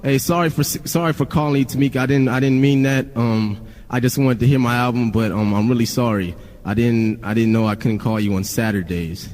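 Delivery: subdued, halting apology in an everyday pitch; meek apology. Retold or imitated. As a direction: imitate your sheepish past self saying sorry. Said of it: "I'm a pussy at first".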